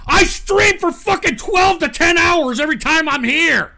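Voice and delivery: exasperated yell; outraged shout. Real, not acted. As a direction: shout your defense at them. Outraged defense to chat.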